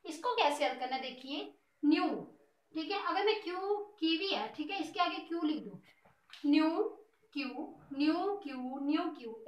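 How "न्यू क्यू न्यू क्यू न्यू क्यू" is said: slow rhyme demo. Shown as a demonstration. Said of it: "एक जैसी tone आ रही है ना".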